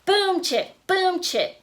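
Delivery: plain reading voice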